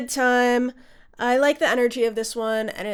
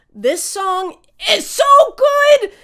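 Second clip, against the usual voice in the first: much higher and louder, slow, real gushing shout; excited shout; 11 semitones higher, 10 dB louder, slower than usual. Real, not acted. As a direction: burst out with real delight. Gushing over favorite song.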